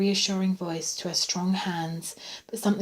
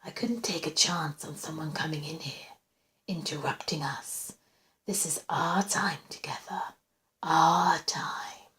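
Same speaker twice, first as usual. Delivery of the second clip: soft, breath-only menace; calm whisper; 3 semitones lower, 6 dB quieter than usual. A narrator's reading. Intruder claiming their time together, calm whisper. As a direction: whisper it calmly, possessively. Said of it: "the voice went on calmly".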